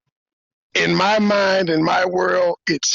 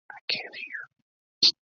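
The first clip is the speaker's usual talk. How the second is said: breathy stage whisper; 10 dB quieter than usual. Real, not acted. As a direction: mouth it in a whisper. Signalling muted guest silently.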